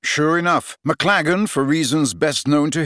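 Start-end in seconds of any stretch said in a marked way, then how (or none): none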